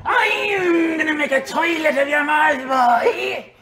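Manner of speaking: high, loud, crowing villain; pirate threat. Acted. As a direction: crow the threat at your victim. Game master voices pirate foe's threat.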